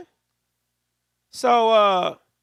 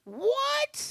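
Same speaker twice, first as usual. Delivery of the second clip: mock surprise; 5 dB quieter than usual. Real, not acted.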